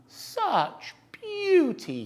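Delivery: high, slow, swooning cry; cried. A narrator's reading. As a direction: gush over the bird's beauty. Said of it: "he cried".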